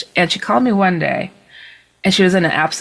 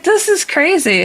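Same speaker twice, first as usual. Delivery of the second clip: disbelieving; 9 semitones higher than usual. Retold or imitated. Reacting to the alien story.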